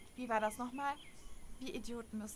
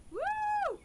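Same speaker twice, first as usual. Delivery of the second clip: high squealing woo; excited whoop; 4 dB louder than usual. Acted. Whooping to her future fans before the fight. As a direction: cheer them into battle.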